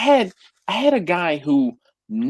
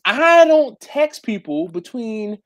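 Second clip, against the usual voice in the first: slow emphatic; 5 semitones higher than usual. Real, not acted. Emphasizing late hour complaint.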